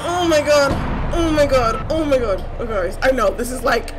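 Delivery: loud, frightened slip into an African accent; scared African accent. Real, not acted. Scared player commentating for viewers, African accent. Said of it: "I get an freaking accent".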